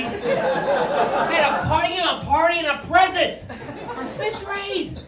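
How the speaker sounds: loud, high incredulous rant; mock outrage. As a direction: blow up at how absurd it is. Mocking fifth grade celebration.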